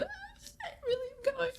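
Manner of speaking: high, breaking voice between laugh and sob; laughing through tears. Real, not acted. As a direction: let it crack into laughing tears. Overwhelmed realisation, laughing and crying.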